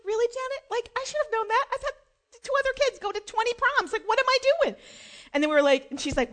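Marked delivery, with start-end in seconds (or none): 0.1-4.8 high-pitched mock self-scolding; self-exasperated. Mother to herself after forgetting the boutonniere, high exasperation. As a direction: chide yourself in a high, incredulous voice.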